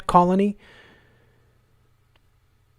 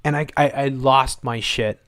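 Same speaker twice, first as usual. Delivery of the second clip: normal talking voice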